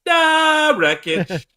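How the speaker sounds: high, loud, drawn-out title shout; mock announcer bellow. Host cutting in with the show title, mock announcer bellow. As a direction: stretch it out and belt it high.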